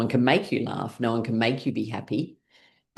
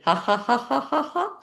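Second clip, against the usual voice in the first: laughing; 11 semitones higher than usual. Shown as a demonstration. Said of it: "and we're laughing".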